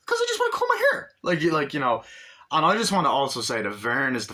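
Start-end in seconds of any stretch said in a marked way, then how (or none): 0.1-1.1 put-on much higher and louder, a squeaky kid voice; Vern's voice. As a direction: squeak it out like a whiny little kid. Vern explains the comb.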